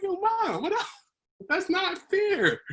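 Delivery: retelling his protest to Mom, high and whiny; mock whiny protest. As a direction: whine it like a wronged kid.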